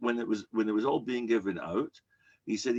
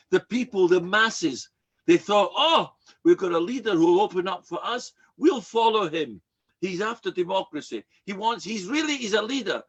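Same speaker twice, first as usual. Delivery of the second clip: louder, rising to a preacher's cry; a preacher's cry of the credulous masses; fervent preaching; 8 semitones higher, 6 dB louder than usual. Acted. A preacher mocks the masses who cheer a leader, loud.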